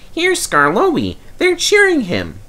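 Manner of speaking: high, excited little engine voice; a young engine hearing his friend approach, excited; eager small engine. Acted. Peter Sam hears the crowd cheering Skarloey, eagerly high.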